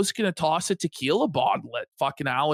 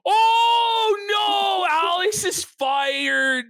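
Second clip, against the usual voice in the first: high, loud, stunned yell of delight; real gasping outcry; excited shout; 13 semitones higher, 4 dB louder, much slower than usual. Real, not acted. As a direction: shout it out, shocked and thrilled. Gasps at Joe's angry reaction.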